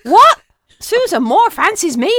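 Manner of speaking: loud, high-pitched disbelief; hammed-up shock, voice shooting up. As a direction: blurt it out in stunned amazement. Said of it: "sitting there in shock".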